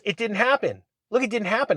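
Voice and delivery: insisting friend. Retold or imitated. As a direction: dig in like a kid. Friend insisting nothing happened.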